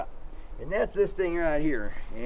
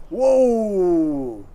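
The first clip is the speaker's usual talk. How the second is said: calm drawn-out whoa; 2 semitones higher, 8 dB louder than usual. Shown as a demonstration. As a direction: settle the dogs with one calm call. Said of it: "I said".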